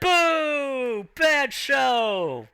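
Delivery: high, drawn-out jeer; booing fans. Acted. Losing fighter's fans jeering the result, high long boo. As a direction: heckle from the stands. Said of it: "Slim Pete's fans are just like".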